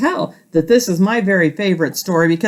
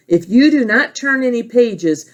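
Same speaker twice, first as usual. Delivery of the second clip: plain reading voice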